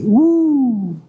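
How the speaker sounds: high whoop of joy; cheering woo. Retold or imitated. Professor celebrating the student's Yale news, whooping.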